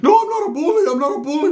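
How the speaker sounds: squeaky high defensive voice; high mock denial. Mocking a cousin who swears he's not a bully. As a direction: whine it up high like a kid. Ricky denies being a bully.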